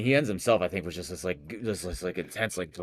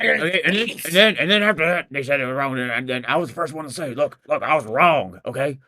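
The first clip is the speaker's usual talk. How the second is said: loud, high stammering protest; defensive conspiracy-host impression; Alex Jones; 5 semitones higher, 7 dB louder than usual. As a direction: backpedal in a heated rant. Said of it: "Alex Jones goes nowhere".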